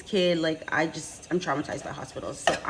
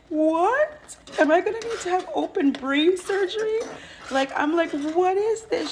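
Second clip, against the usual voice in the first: much higher and louder, alarmed; shocked disbelief; 12 semitones higher, 5 dB louder than usual. Retold or imitated. Hearing she has a brain infection, shocked and loud. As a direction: react like the news just hit.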